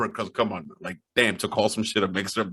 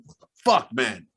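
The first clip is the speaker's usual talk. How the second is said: retold astonishment. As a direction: re-enact a stunned reaction, pitch shooting up. Retelling his reaction while reading high.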